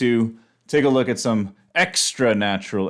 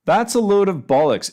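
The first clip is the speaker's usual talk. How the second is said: higher, louder British-flavoured "bollocks"; British expression; 10 semitones higher, 4 dB louder than usual. Shown as a demonstration. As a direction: punch it out like a Brit. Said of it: "entirely British".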